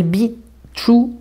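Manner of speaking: Be True to Yourself drawn out slowly in a jokey English accent; a playful put-on English accent on Be True to Yourself; mock English accent. Shown as a demonstration. Reading the canvas title to viewers in a mock English accent. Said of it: "avec mon super accent".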